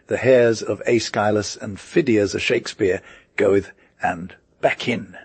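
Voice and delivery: ordinary delivery, nothing marked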